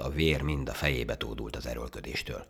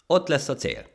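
high, loud announcement; calling out; 13 semitones higher, 8 dB louder than usual. Acted. Rabbit judge pointing out the finish, shouting. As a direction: shout across the course. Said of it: "kiabálta a nyúl".

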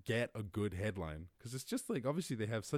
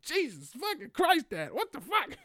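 very high, loud, drawn-out shock at dad; appalled outcry; 8 dB louder than usual. Acted. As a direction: cry out in exaggerated horror. Acted horrified reply to dad.

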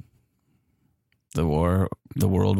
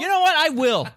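laughing shout. Acted. Stranger laughs and leaves.

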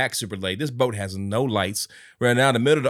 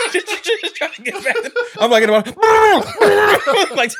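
laughing; 6 dB louder than usual. Retold or imitated. Laughing while retelling.

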